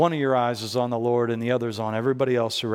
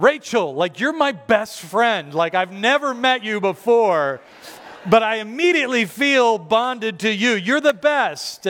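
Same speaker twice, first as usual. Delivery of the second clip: effusive, raised pitch, stretched words; gushing affection; 7 semitones higher, 3 dB louder than usual. Retold or imitated. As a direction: gush like to a brand-new best friend. Gushing thought to customer service rep.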